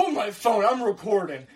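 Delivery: mock urgent yell. Mom yelling mid-argument.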